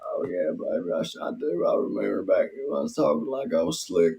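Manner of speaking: Southern politician impression; Bill Clinton. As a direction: play a sly ex-president sweet-talking the room. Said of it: "you would do Bill Clinton".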